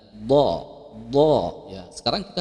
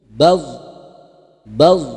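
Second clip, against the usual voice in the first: "Baz" punched loud; emphasis; 3 semitones higher, 9 dB louder than usual. Shown as a demonstration. Teacher drilling heavy za, loud. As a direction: hit "baz" hard each time. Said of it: "Jadi ada penebalan".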